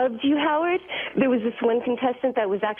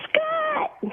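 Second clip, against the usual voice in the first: imitated crowd yell. Caller imitates crowd blaming engineer, high held-back yell. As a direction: call it out like a distant crowd. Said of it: "the crowd would yell out".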